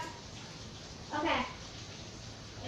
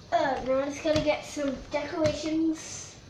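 usual speaking voice

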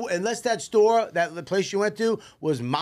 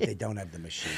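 much softer, lower mocking whine; mock whiny excuse; 10 semitones lower, 10 dB quieter than usual. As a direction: belittle the excuse in a mumble. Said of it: "Stop with".

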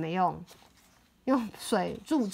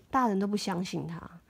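ordinary delivery

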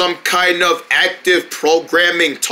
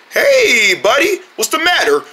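friendly call; 8 semitones higher than usual. Retold or imitated. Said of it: "Just try and tell him".

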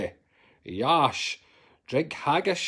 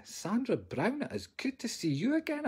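higher sweet greeting; 8 semitones higher, 6 dB quieter than usual. Real, not acted.

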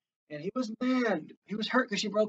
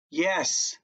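usual speaking voice

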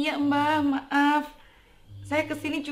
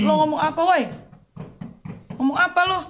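loud demanding shout; angry; 4 semitones higher, 4 dB louder than usual. Acted. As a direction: bark the question. Collector confronts strange voice.

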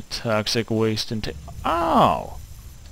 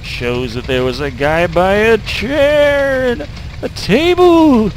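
rising excited read; 9 semitones higher, 7 dB louder, slower than usual. Real, not acted. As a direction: builds to a loud squeal. Reading note in excitement.

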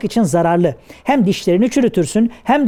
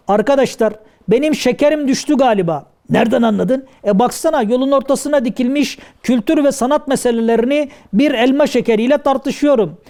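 ordinary talk

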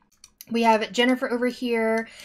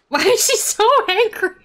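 high, loud question broken by a laugh; amused, giggly outburst; laughing disbelief; 11 semitones higher, 7 dB louder than usual. Real, not acted. Laughing at angry Sim.

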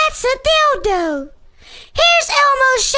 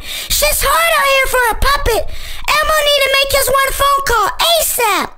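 usual speaking voice

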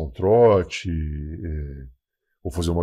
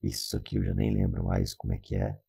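much lower, buzzing robot voice; half robot voice; 11 semitones lower than usual. Shown as a demonstration. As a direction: voice changer robot filter. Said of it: "a famosa voz de robô".

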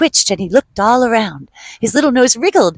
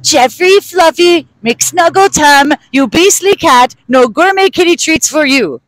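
yelling from another room; 6 semitones higher than usual. Acted. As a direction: call the cat from the next room. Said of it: "someone yells from the other room".

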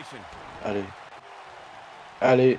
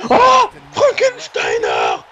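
excited shout; slower than usual. Real, not acted. Big move lands in match.

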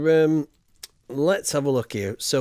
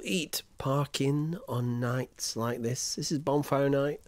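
whisper; 8 dB quieter, slower than usual. Real, not acted. Streamer telling chat about parkin, slow whisper. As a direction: drop into a whisper, slower.

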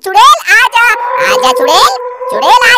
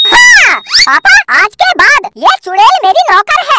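ordinary delivery

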